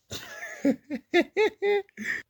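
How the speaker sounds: high burst of laughter at her own joke. Real, not acted. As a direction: break into a high giggle.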